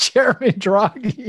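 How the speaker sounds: "Chairman Draghi" said high and slow, mocking his own slip; corrected quote. Guest quoting his own wrong title to the German official, mock-sheepish. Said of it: "And I said".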